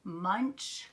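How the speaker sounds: usual speaking voice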